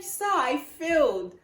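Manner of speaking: high, loud, drawn-out acted wail; mock crying wail. Crying student tells examiner she failed, wailing. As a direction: imitate your own tearful complaint. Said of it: "[name], are you crying?".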